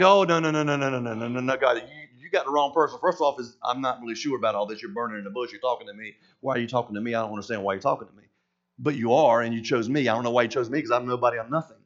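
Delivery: mock panic, dropping low and quiet; freaked out. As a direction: Moses pleading low and unsure with God at the burning bush. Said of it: "He freaked out".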